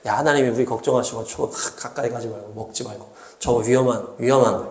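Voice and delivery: Adam diluting God's word, gently fretful; soft, worried caution. Shown as a demonstration. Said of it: "하나님의 이 단호한 이 탁 해야 되는데".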